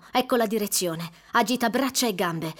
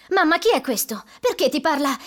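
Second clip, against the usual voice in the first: higher, louder alarmed asking; alarmed question; 6 semitones higher, 6 dB louder than usual. Acted. Nancy asks mother in alarm who the boy is. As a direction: demand answers in confusion.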